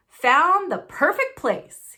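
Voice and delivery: loud, slow, booming satisfaction; pleased Uncle Vernon. Acted. Uncle Vernon announcing his find to the family, booming. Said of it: "he said".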